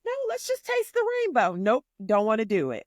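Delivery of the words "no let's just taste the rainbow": high mock friend voice. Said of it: "friends who are like".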